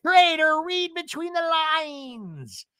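mock shrieking catchphrase, high and drawn out; Shannon. Shannon cursing the traitor, imitated drawn-out screech. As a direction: wail each word slowly in exaggerated imitation. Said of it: "Shannon does her infamous".

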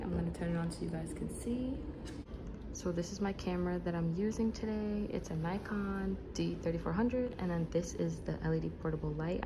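soft, hushed presenting voice; whispering. Real, not acted. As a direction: show your gear quietly, keeping it down for the library. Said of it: "I'm just whispering".

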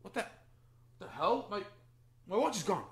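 stammer, then burst out in shock; flustered disbelief. Acted. Man discovers watch is missing.

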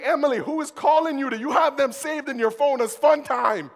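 high, loud panicked questioning. Retold or imitated. Husband panicking at his wife over a phone name. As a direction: demand an answer in a jealous panic. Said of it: "I was panicking".

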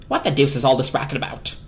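gruff, commanding grumble; imperious squirrel. A narrator's reading. Harold scolds the noisy appliances, imperious. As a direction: demand it like a woken lord. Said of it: "Harold demanded imperiously".

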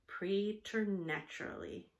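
ordinary delivery

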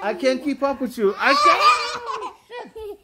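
high, laughing pleas while being tickled; laughing helplessly. Real, not acted. Grandpa wrestled by his granddaughter, laughing and pleading. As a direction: laugh through the words, begging her to let go.

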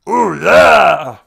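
huge loud rock-star roar; very loud stage yell. Acted. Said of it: "And, uh, [name]".

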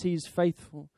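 usual speaking voice

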